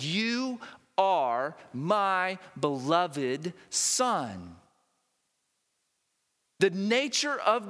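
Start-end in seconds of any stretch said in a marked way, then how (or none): 0.0-4.6 slow tender divine voice. Acted. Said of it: "He says".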